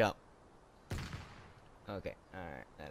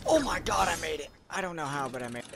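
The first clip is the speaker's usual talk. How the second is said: loud, high relieved cheer; relieved laughter; 9 semitones higher, 8 dB louder than usual. Real, not acted. Clears jump after many deaths.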